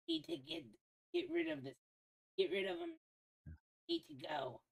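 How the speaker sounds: high, soft put-on boss voice; high mock crime boss; raspy cartel boss role-play. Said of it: "I just had that fucking voice".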